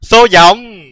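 high, loud announcing call; loud exclamation. Real, not acted. Đọc to dòng chữ rồi lặp lại.

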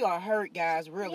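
soft, heavy fake hurt; mock sadness. Vlogger to viewers, soft acted hurt. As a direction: act wounded for the camera. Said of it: "that was an act".

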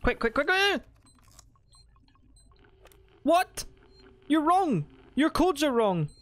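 high, loud frantic pleading; panicked urging. Real, not acted. Player with wrong door codes, frantic shouting. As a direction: hurry the game along in panic.

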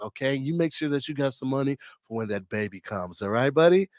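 a hint of a calm, friendly crack dealer; imitating girlfriend's dad. Girlfriend's dad hands over crack, lightly voiced calm dealer. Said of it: "he talked like this".

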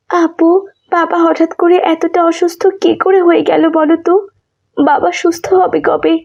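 a younger sister anxiously asking about her sick father; younger sister's voice. Acted. Younger sister asking her elder sister, anxious and high.

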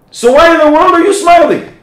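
high, loud, baffled cry; mock astonished outburst; incredulous question. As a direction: throw up your hands in disbelief. Manager baffled by smiling man.

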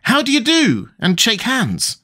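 Tweedledum's indignant high cry; cried out. Acted. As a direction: scold her like an indignant schoolboy. Tweedledum lectures on manners.

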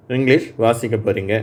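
usual speaking voice

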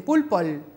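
"pulpol" with an extra l tacked onto the end; added final l. Retold or imitated. Therapist imitating a child adding a final l to pulpo. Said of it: "te dicen pulpol".

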